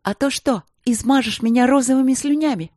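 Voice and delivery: high, spiteful yell; mocking scornful yell; spat-out shout. A narrator's reading. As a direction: fling it like spit. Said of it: "крикнула, как плюнула".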